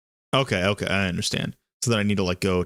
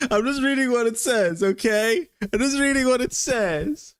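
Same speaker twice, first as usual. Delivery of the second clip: high-pitched, laughing self-defence; laughing protest; 8 semitones higher than usual. Real, not acted. Reader defending the wording to a doubting co-host, laughing high protest. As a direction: protest your innocence through giggles.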